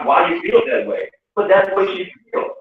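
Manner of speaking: normal talking voice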